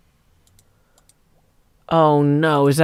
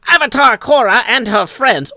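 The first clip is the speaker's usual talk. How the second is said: high, booming mid-Atlantic announcer; Legend of Korra announcer; 8 semitones higher, 4 dB louder than usual. Shown as a demonstration. Streamer imitating a cartoon's recap announcer, booming mid-Atlantic voice. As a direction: belt it out like an old newsreel announcer. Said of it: "the announcer in Legend of Korra".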